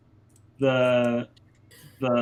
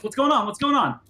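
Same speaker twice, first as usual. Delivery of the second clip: higher, bright "what's going on" with hearty surprise; cheery banker greeting; 8 semitones higher than usual. Acted. Banker to bear friends, a hint of a cheery greeting. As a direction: greet old friends like a delighted host.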